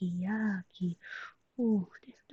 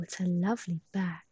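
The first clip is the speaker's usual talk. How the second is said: normal talking voice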